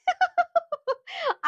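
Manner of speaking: bright high laugh bursting out; high burst of laughter. Real, not acted. Seller laughing at her own dialect slip, high laugh.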